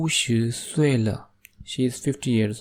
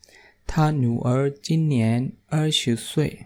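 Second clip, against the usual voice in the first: slow model reading; slower than usual. Shown as a demonstration. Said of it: "teacher models the sentence".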